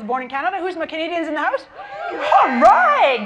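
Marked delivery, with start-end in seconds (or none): 2.1-3.2 high, loud whoop of approval; big, high-pitched rallying shout; loud cheer. Real, not acted. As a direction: cheer on your fellow countrymen. Cheering Canadians in the audience.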